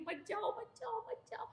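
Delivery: high, hushed mock plea; acted pleading. Daughter trying to stop her mother leaving, high soft pleading. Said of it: "वो रोक रही है".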